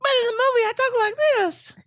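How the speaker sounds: suddenly very high, tiny voice for a big man; high squeaky voice. Retold or imitated. Big tough guy mocked with a tiny high voice. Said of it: "he's got a regular voice".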